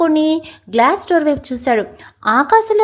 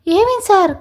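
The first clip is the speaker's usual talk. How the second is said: worried question pitched higher; anxiously; 6 semitones higher than usual. Acted. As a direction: ask in a sudden flustered panic. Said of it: "కంగారుగా అడిగింది".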